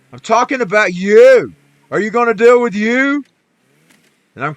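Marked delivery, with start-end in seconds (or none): 0.1-3.2 prophet shouting his rebuke at the king; retold shout. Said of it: "He said".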